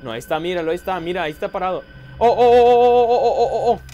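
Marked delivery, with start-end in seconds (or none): 2.2-3.8 loud frightened oh oh; scared cries. Real, not acted. Gamer cries out in fear at a scare. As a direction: cry out in fear, again and again.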